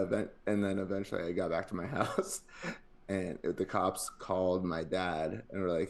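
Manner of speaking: ordinary delivery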